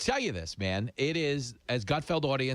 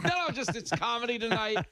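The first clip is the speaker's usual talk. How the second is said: laughing denial; 10 semitones higher than usual. Retold or imitated. Retells being asked to kill.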